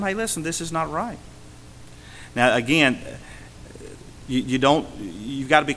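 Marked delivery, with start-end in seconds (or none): 0.0-1.2 quiet rebuke. Retold or imitated. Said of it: "just tell them".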